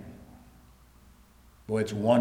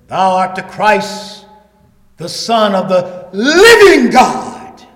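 slow build to a loud, drawn-out "living God"; emphasis on living God; 8 semitones higher, 14 dB louder, slower than usual. Retold or imitated. Preacher quoting Peter's confession, "living God" strongly stressed. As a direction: hit "living God" hard and stretch it out. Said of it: "Why the emphasis on the living God".

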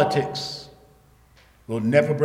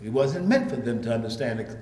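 ordinary talk

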